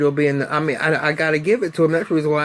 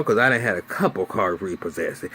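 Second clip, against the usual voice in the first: normal talking voice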